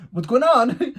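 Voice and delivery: loud, high-pitched mock alarm; bewildered outburst. Retelling reaction to date's meltdown, loud high-pitched bewilderment. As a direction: blurt it out high, totally baffled.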